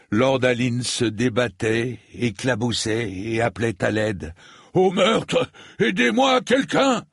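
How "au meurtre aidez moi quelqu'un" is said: desperate high cry for help; shouting for help. A narrator's reading. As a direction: yell it from the water. Lord calling for help from well.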